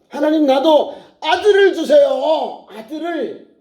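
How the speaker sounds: high, drawn-out imploring cry; pleading prayer. Acted. Preacher as childless Hannah begging God for a son, pleading. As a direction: cry out slowly as if begging heaven.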